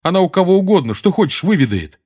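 brusque, agitated rebuttal; sharp. A narrator's reading. Seamus answering Dean about his mother, sharp. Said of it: "резко сказал ему Симус".

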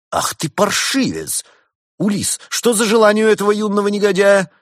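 high, loud scandalised scolding; 1 indignant scolding. A narrator's reading. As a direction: tear into the brat. Антиквар ругает подростка. (антиквар возмущённо отчитывает подростка, высоко и громко)

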